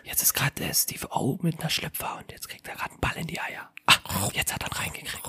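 hushed whispered live commentary; whispering in the cinema. Pretend. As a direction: whisper so the audience won't hear. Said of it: "So ganz leise die ganze Zeit".

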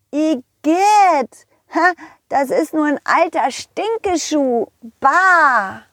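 put-on high-pitched boy going yuck; disgusted little pirate. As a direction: recoil from a stinky find, drawing out the yuck. Boy disgusted by stinky shoe.

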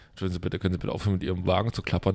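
fast, soft, low polite request; overly polite. Retold or imitated. Customer imagining asking the man to stop, rushed and meek. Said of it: "anquatsche und sage".

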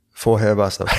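cracking up mid-sentence; breaking into giggles; laughing fit. Real, not acted. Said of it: "dieses Thema finde ich jetzt schon so lustig".

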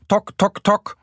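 high, staccato tapping imitation; woodpecker knocking. A narrator's reading. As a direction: tap out each syllable like a beak.

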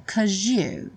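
"'Cause you" joined with a j sound, kozhu; cause you linked. Shown as a demonstration. Teacher models the join: "'Cause you" said as kozhu. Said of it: "um som de J".

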